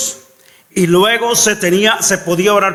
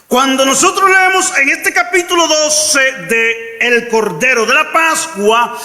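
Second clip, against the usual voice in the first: rising sermon fervour, near shouting; preaching at full force; 6 semitones higher than usual. Acted. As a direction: preach it out to the congregation.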